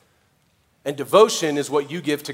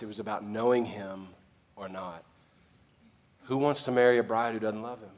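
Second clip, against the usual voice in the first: normal talking voice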